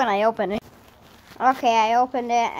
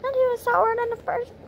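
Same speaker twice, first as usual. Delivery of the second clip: high strained voice; 13 semitones higher than usual. Real, not acted. Reacting to sour taste.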